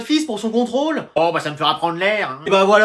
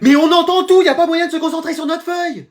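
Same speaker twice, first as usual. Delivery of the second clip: high-pitched pupil's complaint; exasperated protest; 8 semitones higher than usual. Acted. Pupil scolding chattering teachers, exasperated protest. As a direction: protest in a raised, indignant pitch.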